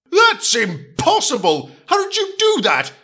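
shocked Gordon. Acted. Gordon stunned that Edward caught him, very high. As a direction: gasp it out in shock.

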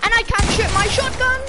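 loud, high excitement; excited gamer. Real, not acted. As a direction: shout it in the heat of the fight. Excited moment in a firefight.

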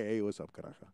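low, soft casual local greeting; retold local greeting. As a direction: toss off a low, quiet hello. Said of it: "they're like".

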